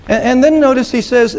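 ordinary talk

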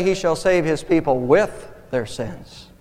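slow, punching "with" before a pause; stress on "with". Shown as a demonstration. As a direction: hit "with", then let "their sins" fall. Contrasting the preposition with the verse.

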